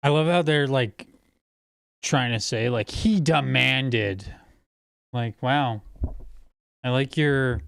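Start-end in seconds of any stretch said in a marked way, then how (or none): 2.9-4.3 DEMANDED drawn out slowly; mocking emphasis. Streamer quoting critics' choice of words, mockingly drawn out. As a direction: stretch out "demanded" in mock quotation.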